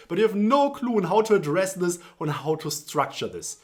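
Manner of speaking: ordinary delivery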